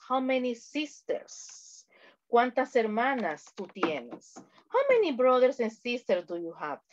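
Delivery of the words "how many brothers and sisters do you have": normal talking voice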